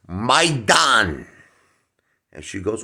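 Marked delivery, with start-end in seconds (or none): 0.1-1.3 "My Dan" called high and loud. Retold or imitated. Comic calling to the waitress, high and loud. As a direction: call the waitress over.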